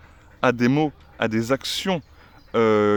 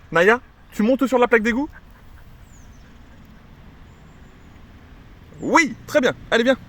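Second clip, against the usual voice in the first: high excited command; 11 semitones higher than usual. Real, not acted. Trainer urging Naya onto the drain cover, high and excited. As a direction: sing it up high to encourage her.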